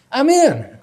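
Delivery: high, loud self-satisfied "I'm in."; smug inner voice. Retold or imitated. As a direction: crow it like you've got it made. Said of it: "what you thought".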